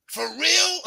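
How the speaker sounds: high shouted disbelief; loud high incredulous question; shocked for real. Retold or imitated. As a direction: yelp it in disbelief.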